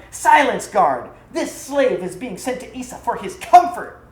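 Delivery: loud high furious shout. A narrator's reading. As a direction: silence him with full force. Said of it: "And Nor shouted".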